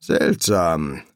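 low-key, brooding mumble; grumbled. A narrator's reading. Serpent musing after watching the rain, grumbling. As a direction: grumble it pensively. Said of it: "brummte Kriecher schließlich".

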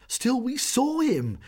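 high, loud, slow insistence; fearful declaration. A narrator's reading. Jeanne insisting to Sorelli, frightened and high. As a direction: plead that it was real. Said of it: "Jeanne declared".

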